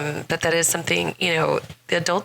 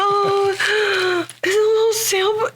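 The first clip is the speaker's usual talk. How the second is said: excited gasp; 13 semitones higher, slower than usual. Real, not acted. Gasps opening a cute gift.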